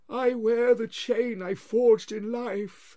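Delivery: high, slow, loud moaning spectre; wailing ghost. Acted. Marley's ghost wails at Scrooge, slow and loud. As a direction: drag each word out like a wail from the grave.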